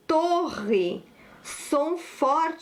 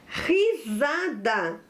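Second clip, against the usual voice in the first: "Risada" drawn out syllable by syllable; slow dictation; 2 semitones lower, much slower than usual. Shown as a demonstration. Teacher gives pupils the next word, slow dictation. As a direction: say "Risada" slowly, each syllable apart.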